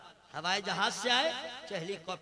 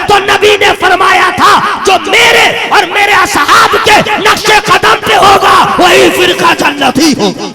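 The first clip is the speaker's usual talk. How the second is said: loud high fiery preaching, shouted to the crowd; preaching at full force; 9 semitones higher, 28 dB louder than usual. Acted. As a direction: thunder it out like a jalsa orator. (जोशीली तकरीर, ऊँची आवाज़ में)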